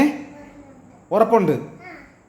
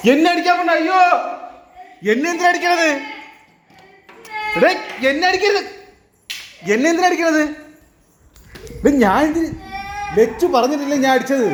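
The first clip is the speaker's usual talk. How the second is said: mock pleading; 5 semitones higher than usual. Pleading not to be hit.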